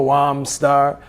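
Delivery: loud snippet of Jamaican patois; mock Jamaican patois greeting. Shown as a demonstration. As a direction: briefly imitate Jamaican slang. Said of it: "do the Jamaican patois".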